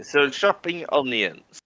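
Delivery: usual speaking voice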